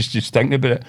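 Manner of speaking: ordinary talk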